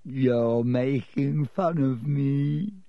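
slow, wounded moan; tearful.

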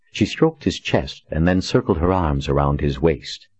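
ordinary delivery, nothing marked

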